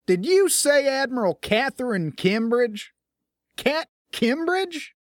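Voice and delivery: can't believe who's calling; stunned lieutenant hears a legendary name; stunned disbelief. A narrator's reading. Officer stunned by her name.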